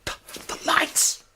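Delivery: startled, breathless gasp of words; stammered hushed alarm; alarmed whisper. Acted. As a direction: caught off guard in the dark. Lights suddenly go out.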